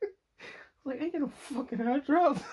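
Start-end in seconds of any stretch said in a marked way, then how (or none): 1.0-2.4 very high, loud mock protest; hammed-up squeaky indignation; laughing refusal. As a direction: squeal it, barely holding back laughter. Repeats refusal while laughing.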